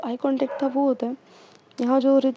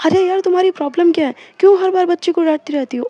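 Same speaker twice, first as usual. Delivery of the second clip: loud, fast, higher exasperated telling-off; retold angry outburst, louder and quicker; angry scolding; 4 semitones higher, 8 dB louder, faster than usual. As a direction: snap at her for always scolding the child. Riddhi confronts Arya over scolding.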